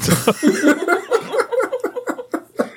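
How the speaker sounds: hohes, prustendes Kichern; hohes Lachen. Real, not acted. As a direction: kichert hoch los. Lachen über eigenen Betrunkenen-Witz. (kichert hoch über den eigenen Witz)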